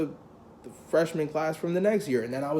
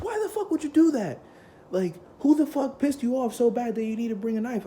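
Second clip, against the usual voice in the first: very high-pitched mock outrage; incredulous scolding; 11 semitones higher, 3 dB louder than usual. Band teacher to student who brought a knife, incredulous. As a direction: imitate a teacher's high, appalled disbelief.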